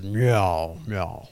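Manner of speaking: low, loud meow answer; God meowing. Retold or imitated. God answers the cat in meows, low. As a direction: meow back low and steady. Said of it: "God says".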